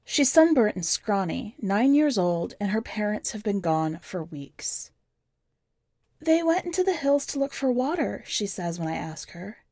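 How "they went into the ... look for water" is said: put-on higher young girl's voice, plain and matter-of-fact; the kid's voice. A narrator's reading. The kid telling the stranger about her parents, in a higher child voice. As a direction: lift the pitch to play the nine-year-old.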